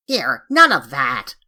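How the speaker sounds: gruff creature. Acted. Samoyed grumbles at being held.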